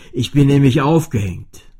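laut erklärend; erklär es empört; Cellostimme. A narrator's reading. (laute Cellostimme)

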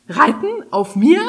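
flabbergasted, each word its own question; aghast disbelief. A narrator's reading. Dragon balks at being ridden by Kari, aghast. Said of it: "ein entgeisterter Blick traf sie".

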